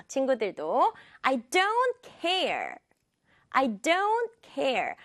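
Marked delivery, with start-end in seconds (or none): 1.2-4.9 "I" touched briefly, "don't" high and drawn out; light I. Shown as a demonstration. Teacher leading repetition, quick "I" then stressed "don't care". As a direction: skim over "I", land on "don't care". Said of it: "아이는 찍고 넘어간다".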